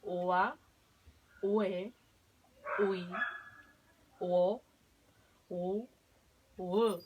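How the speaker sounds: sílabas ua, ue, ui, uo, u dichas sueltas y marcadas; sílabas de práctica. Shown as a demonstration. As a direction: pronuncia "Ua, ue, ui, uo, u" una por una. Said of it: "lo practicamos una vez más". (modelo de pronunciación de sílabas)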